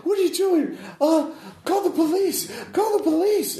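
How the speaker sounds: very high, frantic yell for the police; panicked scream. Acted. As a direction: shriek it out like a terrified hostage. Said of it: "he screams".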